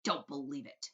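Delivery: plain reading voice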